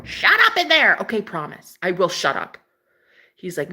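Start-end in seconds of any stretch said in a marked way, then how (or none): 0.0-1.0 higher-pitched mock yell; mock shout through the wall. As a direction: call out a playful scolding. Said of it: "just go".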